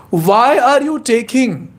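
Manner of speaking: acted incredulous telling-off; scolding. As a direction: stretch out the opening, then climb in exasperation. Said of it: "he scolded nicely".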